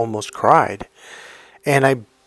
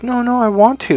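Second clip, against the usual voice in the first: high-pitched, louder imitation of a pleading girlfriend; 11 semitones higher, 4 dB louder than usual. Girlfriend insisting to boyfriend that she wants to, pleading in a high voice. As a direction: mimic her insisting in a much higher voice. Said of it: "she was starting to get sad".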